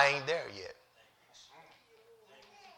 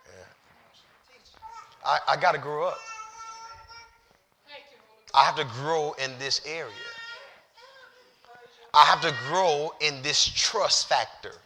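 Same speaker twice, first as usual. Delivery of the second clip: holding back tears; 4 dB quieter than usual. Real, not acted. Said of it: "I'm trying not to cry".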